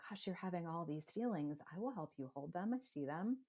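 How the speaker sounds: soft, calm reassurance; gentle empathy. Shown as a demonstration. Parent bearing witness to a child's feelings, soft and calm. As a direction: hold space for the child softly. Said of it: "be able to say".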